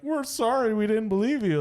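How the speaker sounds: high-pitched, hammed-up apology; imitated sheepish grown-ups; mock apologetic parents. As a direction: plead sorry in a high parent voice. Voices apologetic parents in movies.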